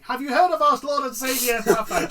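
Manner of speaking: loud, high door-knocker pitch; hammed-up evangelist selling Pathfinder; mock preacher. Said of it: "stop coming around like you're Mormons and going".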